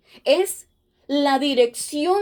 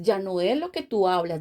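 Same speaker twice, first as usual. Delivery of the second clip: usual speaking voice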